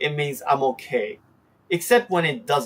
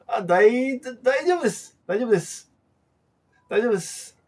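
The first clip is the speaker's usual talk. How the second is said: hesitant declining, trailing off; hesitant polite refusal; 8 semitones higher, much faster than usual. Shown as a demonstration. Host politely declining a refill from a friend, hesitant and fading. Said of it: "I'm trying to not say no".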